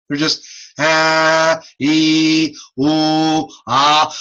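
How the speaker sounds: held pure vowels ah, ee, ooh; vowel demo. Shown as a demonstration. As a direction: sustain each vowel plainly. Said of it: "Vowels are not using my lips".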